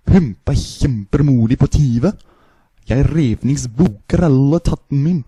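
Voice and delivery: Norwegian-sounding gibberish; fake Norwegian. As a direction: babble like Norwegian. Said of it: "the last one is the Norwegian".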